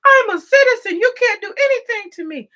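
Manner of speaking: high, loud mock defiance; mocking Ilhan. Vlogger mocks Ilhan's boast, high loud squeal. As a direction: shriek it like a smug politician. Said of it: "Ilhan got her dumb ass up there".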